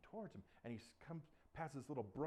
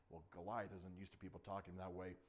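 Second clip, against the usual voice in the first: ordinary delivery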